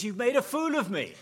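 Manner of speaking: high, loud outburst of wounded pride; angry shout. Acted. Balaam yelling at his donkey, high and loud. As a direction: bellow it like a humiliated master.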